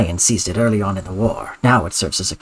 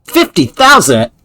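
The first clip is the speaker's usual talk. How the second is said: loud greedy demand; 6 semitones higher, 8 dB louder than usual. Acted. Mercenary demands huge price.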